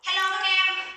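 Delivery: muffled voice demo. Shown as a demonstration. Teacher shows students a muffled voice again. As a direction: pretend a voice changer garbles you. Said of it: "Bây giờ chị nói các em bình thường".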